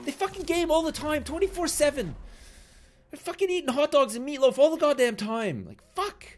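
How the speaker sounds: loud furious rant at nerds; raging gamer. Real, not acted. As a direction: yell it out, furious and high. Raging at other players.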